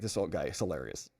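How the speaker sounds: ordinary delivery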